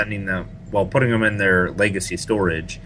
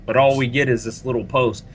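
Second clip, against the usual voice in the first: normal talking voice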